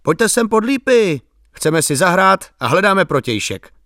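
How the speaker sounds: high-pitched mock call to people afar. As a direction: call them over, pitched well up. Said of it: "zavolat na ně".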